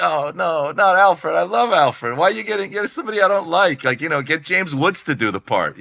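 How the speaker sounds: high, pleading fan's protest; dismayed, high-voiced complaining; acted-out dismay. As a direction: wail in protest, pitched well above your talk. Acts out own dismayed fan reaction.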